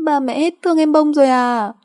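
put-on crying child. Small daughter crying in mother's arms, wailing. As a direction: wail hurt, like a little child. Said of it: "lại càng khóc lớn hơn".

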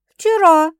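ordinary delivery, nothing marked

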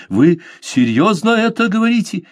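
high, startled disbelief; astonished question. A narrator's reading. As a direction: jump up in surprise and ask. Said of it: "привстал от удивления".

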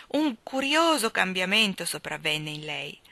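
ordinary delivery

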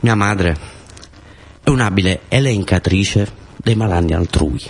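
ordinary talk, nothing marked

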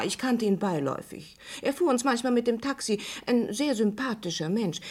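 normal talking voice

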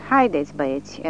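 usual speaking voice

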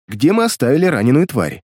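plain reading voice

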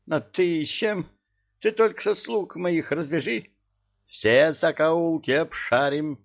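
high, agitated king's voice. A narrator's reading. King offers to search every corner, agitated. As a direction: fret in a high-pitched voice. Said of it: "заволновался Аддимирьяту".

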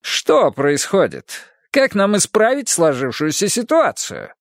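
lightly voiced pushy landlord; smooth landlord. A narrator's reading. Landlord Dyle speaks. (слащавый напористый домовладелец)

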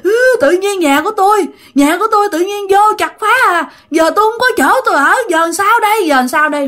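high, tearful acted wail; mock-cry the complaint in a high voice; wailing ghost woman. Ghost weeps to the man over her lost home, high acted wailing. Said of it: "Bả đổ, bả khóc, bả nói".

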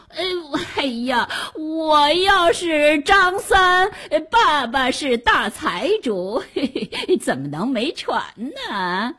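high, drawn-out protest, easing into a sly chuckle; wheedling rogue. Acted. Drowning rogue denies who he is, wheedling wail. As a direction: wail the denial, then soften with a little laugh. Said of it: "他连忙说".

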